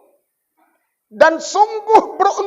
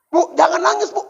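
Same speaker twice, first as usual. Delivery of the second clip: acted widow scene; 3 semitones higher than usual.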